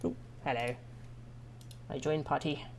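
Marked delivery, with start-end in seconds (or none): none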